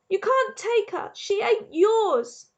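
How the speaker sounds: anguished scream. A narrator's reading. A boy screaming at his father over his dead sister. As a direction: scream it out in raw grief. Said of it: "Bryce screamed".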